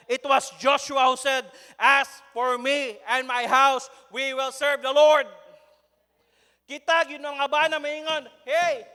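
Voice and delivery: loud, emphatic sermon delivery; full-force preaching. Real, not acted. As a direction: preach it out to the congregation, driving each point.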